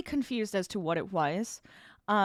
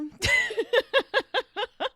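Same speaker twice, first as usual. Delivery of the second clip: loud high laugh; big bright laugh; 14 semitones higher, 8 dB louder than usual. Real, not acted. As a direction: laugh at own madness. Laughing about going insane.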